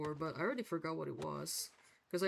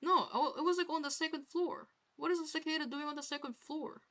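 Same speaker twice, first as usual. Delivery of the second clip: ordinary delivery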